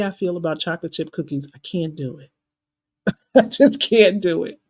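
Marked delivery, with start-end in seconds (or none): 3.2-4.6 laughing. Real, not acted. Confessing cookie weakness, laughing.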